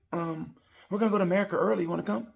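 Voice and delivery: high, quick, upbeat invitation; cheerful coaxing. Retold or imitated. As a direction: rush out a hopeful invite in a high voice. Coaxing a sulking friend.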